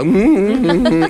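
high hummed mute grunts; mute grunting. Retold or imitated. Boy in a mute role grunting at the family, high hums. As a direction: grunt like a servant who cannot speak.

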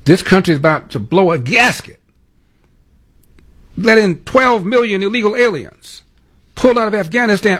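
high-pitched parody of a ranting pundit; mocking imitation. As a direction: mimic his agitation in a squeaky put-on voice. Mocking echo of the clip just played.

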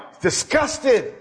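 disgusted. Acted. Preacher naming Jesus' disgust, slow and high. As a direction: let the disgust show, slowly.

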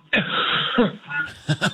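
choking on cereal, coughing hard; real coughing fit. Real, not acted. Caller choking on cereal mid-call, real coughing. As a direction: cough as if something's stuck. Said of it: "I thought you were acting".

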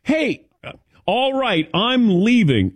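high, halting, fumbling retort, slowed down; mock flustered self. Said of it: "I was like".

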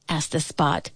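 usual speaking voice

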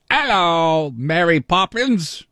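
high, hammed-up British accent, drawn out; bad British accent. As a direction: put on a terrible British Mary Poppins voice. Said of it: "British accents. How about me?".

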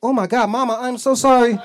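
high, loud panicked apology; alarmed apology. Retold or imitated. Son apologizing to Mama for the slap, alarmed. Realizes he slapped his mother.

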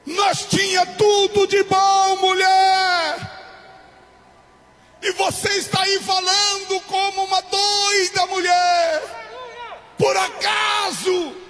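high, drawn-out preacher's cry; impassioned shouted rebuke. Acted. Job answering his wife who lost faith, impassioned rebuke. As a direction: rebuke her at full voice, stretching key words.

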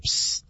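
hushed, voiceless "psst"; secretive psst to a library neighbor. Retold or imitated. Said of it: "leaned over to somebody in a library and said".

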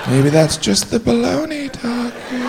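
high, slow, hushed aside; drawn-out, high put-on voice; commenting onlooker aside. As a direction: float it out softly up high. Inner-critic aside in high voice.

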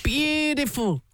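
put-on slow, drawn-out Greek-Australian accent; a half-hearted Greek-Australian accent; Con the Fruiterer accent. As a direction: stretch it out, Greek-Australian style. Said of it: "you really bought right into the accent of Con the Fruiterer".

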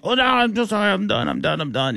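put-on high, hasty Indian-accented apology fading down; a drunk man promising he's finished, Indian accent; imitated Indian accent. Passenger caught relighting blunt, mock Indian accent. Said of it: "Indian accent".

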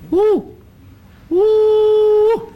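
high hooting woo, loud and rising; bunyip mating call. Acted. Old bushman shows the mating call of the wild bunyip, hooting loud and high. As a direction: hoot it out like a wild creature calling its mate. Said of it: "It's like this".

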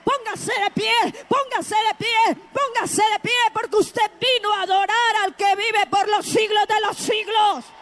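shouted, repeated call to worship; driving, impassioned pulpit chant; rousing full-force preaching. Real, not acted. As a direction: urge the congregation to their feet at full voice. Rouses congregation at full force.

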